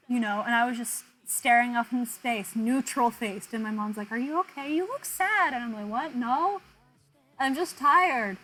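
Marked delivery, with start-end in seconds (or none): 4.1-5.5 rising to a high sad; light imitation of a fussing mother; mock mom concern. Mom's quote, retold. 5.9-8.4 tired protest. Retold or imitated. Her reply to mom.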